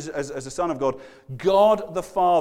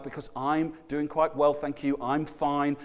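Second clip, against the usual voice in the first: smug polite refusal; 4 semitones lower, 6 dB quieter than usual. Retold or imitated. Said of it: "They're the ones".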